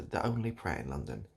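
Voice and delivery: ordinary talk, nothing marked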